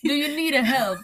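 high, loud mock-Scottish accent, breaking up laughing; attempted Scottish accent. Woman retries the helper's offer, laughing Scottish accent. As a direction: giggle through a Scottish accent that slips toward Irish. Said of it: "like, an Irish".

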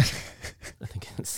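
unvoiced whisper trailing off. Real, not acted. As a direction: drop to a secretive whisper. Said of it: "trailing off into a whisper".

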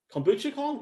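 kombucha said high and questioning; high, teasing disbelief at the word; mock surprise. Real, not acted.

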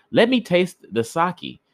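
high, put-on neighbor's voice; greedy neighbor demanding a taste. A narrator's reading.

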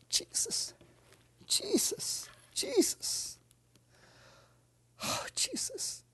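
soft high whispered Jesus, repeated; whispered prayer. Real, not acted. Preacher whispering prayer before the congregation. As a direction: breathe his name over and over.

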